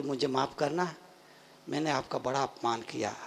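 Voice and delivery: low, very soft contrition; tearful apology, understated. Acted. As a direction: apologise humbly under the breath. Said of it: "पश्चाताप लिए, आंखों में आंसू लिए". (धीमे स्वर में पछतावे भरी माफ़ी)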